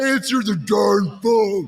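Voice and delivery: slow, drawn-out mock holler; ringtone shout. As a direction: bark it like a ringtone voice. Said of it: "is that your ringtone".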